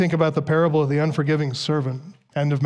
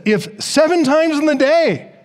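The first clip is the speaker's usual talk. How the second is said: SEVEN TIMES raised high and stretched slowly; emphatic quotation; 9 semitones higher than usual. Retold or imitated. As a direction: lean hard on "seven times in the day", high-pitched and slow. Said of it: "seven times of offense is what gets the emphasis".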